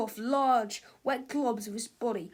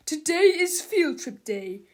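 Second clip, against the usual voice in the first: loud, higher announcement; gruff shout; 5 semitones higher, 8 dB louder than usual. A narrator's reading. Mister Krupp announcing the trip, loud. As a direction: bark it at the class. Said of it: "he shouted".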